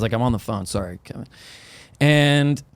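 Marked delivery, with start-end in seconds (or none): 0.1-1.3 dismissive. Retold or imitated. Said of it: "points to his fucking bullshit Bluetooth and says".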